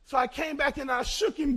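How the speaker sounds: ordinary talk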